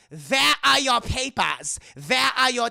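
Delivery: loud, slow, barked demand for papers; a mock German officer barking orders; barking Nazi officer. Officer barking at passengers for their papers, loud and slow.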